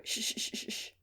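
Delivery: quick string of hushes; acted "be quiet" hissing; urgent shushing. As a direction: shush someone repeatedly. Acts Gary hushing his dad.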